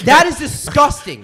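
high, slow, indignant exclamation; revolted outburst at a roommate; loud disgust. Real, not acted. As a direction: stretch it out, loudly appalled. Disgusted at roommate's eggshell habit.

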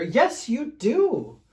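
loud, high, drawn-out contradiction; insistent storybook growl. A narrator's reading. Fluffy bear arguing about a bath, loud drawn-out insistence. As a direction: insist slowly, raising pitch and volume. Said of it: "growled Big Fluffy Bear".

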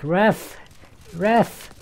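high, loud repeated shout; calling the ref. Real, not acted. Player calling the referee over a stuck ball, insistent shout.

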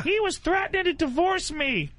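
high mocking whine as the wife. Mocking the wife's excuse.

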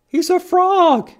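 high, loud excited reveal. Acted. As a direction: announce it with big delight.

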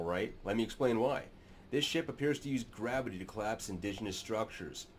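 normal talking voice

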